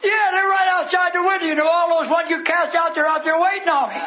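high demon cry, insisting; hollering devil. Retold or imitated. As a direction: plead the case as a cornered devil. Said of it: "the devil hollered to Jesus".

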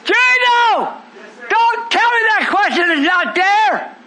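preacher challenging Gino; calling out. Real, not acted. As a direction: call Gino out by name. Calling out a congregant.

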